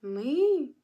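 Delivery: normal talking voice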